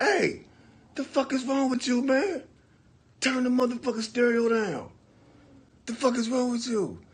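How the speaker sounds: high, loud furious shouting; angry yell. Retold or imitated. Storming downstairs yelling at the friend over the stereo. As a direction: chew him out for blasting the stereo.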